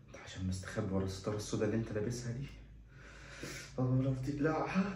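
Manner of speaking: whispered jab at the proctor; muttered aside. Acted. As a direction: mutter it under your breath.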